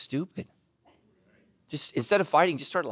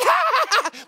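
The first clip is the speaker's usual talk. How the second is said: high, loud exaggerated cackle; hammed-up laughing fit at someone else's blunder; mock guffaw; 6 dB louder than usual. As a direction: burst into a big show-off belly laugh. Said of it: "And I'm just like".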